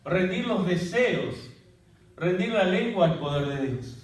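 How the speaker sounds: slow, drawn-out solemn exhortation; slow preaching. Acted. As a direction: lay each phrase down slowly like a prayer.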